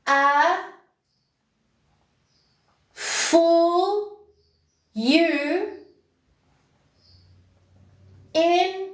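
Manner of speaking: word by word, long pauses between; slow dictation. Shown as a demonstration. To a viewer who asked to repeat it, slow word-by-word dictation. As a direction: spell it out slowly so they can write it down. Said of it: "repeat that breakdown".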